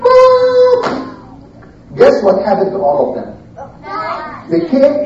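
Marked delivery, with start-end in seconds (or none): 0.0-0.8 sustained, very high beam noise; high whooshing "ooh". Shown as a demonstration. Light flashing in the night, high sustained whoosh. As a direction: hold a high note for the beam. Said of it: "Like this".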